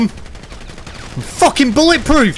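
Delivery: cocky Luke Cage. Pretend. Bulletproof hero boasts to shooters, cocky shouting. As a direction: crow it at your attackers.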